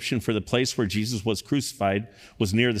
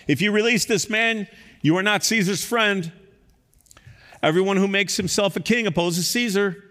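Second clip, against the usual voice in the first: high, raised threatening cry; crowd crying out; 11 semitones higher, 3 dB louder than usual. Acted. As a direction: threaten Pilate with Caesar.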